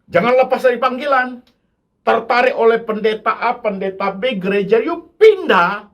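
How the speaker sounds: loud, high fervent preaching; impassioned pulpit cry; full-force preaching. Acted. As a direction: preach it hard to the congregation.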